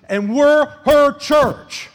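slow, loud, drawn out; emphatic declaration. Real, not acted. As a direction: lean on every word. Emphatic preaching climax.

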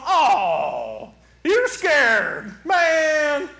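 loud mocking pity, swooping up; making fun. Imagined Jesus to scared follower, loud mocking. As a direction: jeer with a hammed-up drawn "oh". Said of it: "I don't think he makes fun of me".

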